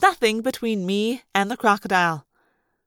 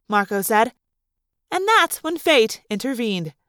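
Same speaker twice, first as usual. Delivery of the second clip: plain reading voice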